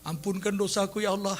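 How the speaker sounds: soft plea breaking into fake laughter; mock laughing prayer. Preacher parodies someone who laughs while praying, mock laughing prayer. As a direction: pray softly, then cackle absurdly. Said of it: "yang berdoa ketawa".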